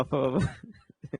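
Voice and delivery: "फ, ब, भ" said very slowly, each lip sound pressed out; slow, drawn-out demo of "फ, ब, भ". Shown as a demonstration. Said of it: "बोलिए जरा प".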